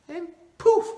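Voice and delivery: high, loud burst on poof; magic poof. Acted. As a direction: pop it out like a magic spell. Wish magically granted.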